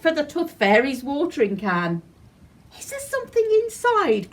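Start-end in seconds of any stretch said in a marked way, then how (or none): 2.8-4.3 put-on high, quick little girl voice; high puzzled child asking; curious frowning girl. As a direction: peer in suspiciously as a child. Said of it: "Gracie said with a frown".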